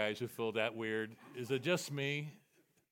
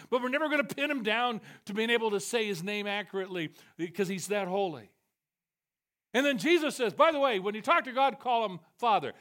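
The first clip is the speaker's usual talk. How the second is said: loud, high preaching at full force; fiery preaching; 6 semitones higher, 6 dB louder than usual. Acted. Preacher to congregation, voicing God in a loud ringing sermon.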